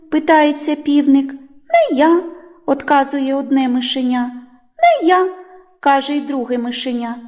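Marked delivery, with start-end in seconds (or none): 1.3-2.2 lightly voiced higher, louder mouse; quick high refusal as a little mouse; a touch of squeaky mouse; little mouse refusing. A narrator's reading. Lazy mouse refuses in story. 4.8-5.3 lightly voiced higher, louder mouse; quick high refusal as a little mouse; a touch of squeaky mouse; little mouse refusing. A narrator's reading. Second mouse refuses in story.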